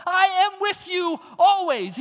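high, loud preaching shout on I; preached shout. Acted. Preacher proclaiming Christ's promise, shouting. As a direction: cry it out to the church. Climax of Jesus' promise quoted.

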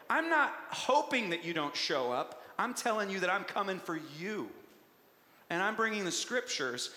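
ordinary delivery

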